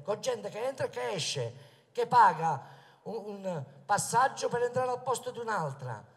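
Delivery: ordinary delivery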